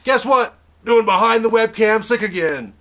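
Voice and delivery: high and loud, bursting with news; excited shout. Real, not acted. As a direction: shout it out eagerly.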